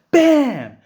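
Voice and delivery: sudden high yelled punch sound; shouted bam. Retold or imitated. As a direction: blurt the hit out loud and sharp. Retelling a punch, sound effect.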